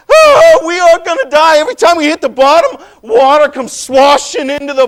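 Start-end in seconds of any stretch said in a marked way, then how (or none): 0.1-1.6 high, loud wail of doom; panicked cry. Acted. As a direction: cry out as the boat plunges. Retelling his panic in storm.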